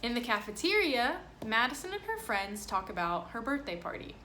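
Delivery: lively picture-book reading voice; reading to children. Acted. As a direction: read it aloud to a class. Reads picture book to class.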